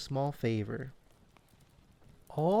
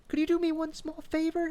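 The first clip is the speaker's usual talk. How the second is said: much higher, eager little chipmunk voice; excited chipmunk. Acted. Chip the Chipmunk asking Remy for a favor, high and eager. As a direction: pitch it way up like an eager young chipmunk. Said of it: "he asked".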